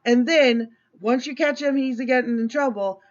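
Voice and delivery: normal talking voice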